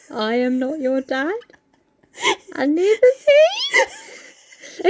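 slow, drawn-out reading, rising to a high laughing end. Shown as a demonstration. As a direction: stretch each word out as asked. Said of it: "could you say that again a little slower".

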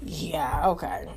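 deep, quiet, deadpan "yeah, okay"; sarcastic dismissal. Retold or imitated. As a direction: brush it off flatly. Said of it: "I'm like".

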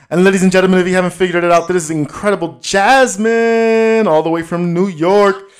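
loud, hyped guest introduction; announcer intro. Acted. Host introducing Jasmine to listeners, loud hype announcer. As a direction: present her like a star.

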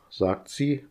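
usual speaking voice

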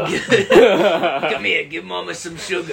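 laughing sultry mama voice; milf voice. Pretend. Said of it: "describing his milfs".

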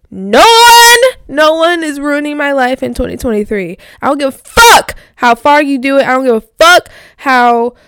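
loud, defiant rant. Real, not acted. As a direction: declare it loud and fierce. Defiant rant at those who hurt her.

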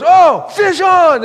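drawn-out loud high shout; dad's shout. Retold or imitated. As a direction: bellow it so everyone clears out. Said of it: "then he goes".

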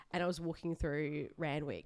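ordinary talk, nothing marked

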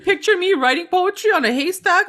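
put-on high, eager farm-boy voice; mock eager Archie. Friend mimics Archie dreaming of farm life, giddy and high. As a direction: play him giddy about farm life.

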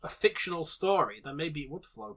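usual speaking voice

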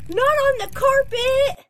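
high, loud wail of dismay; dismayed cry. Acted. Owner sees dog peeing on carpet, dismayed cry. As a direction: cry out to stop a disaster.

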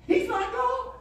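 high, loud, slow preaching; high loud slow pulpit climax; preaching at full force. Acted. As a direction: drive each line home. Sermon climax.

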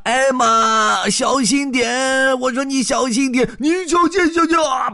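high, loud cries of warning; warning shout. Acted. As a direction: yell at them to watch out. Elephants warning on the ice.

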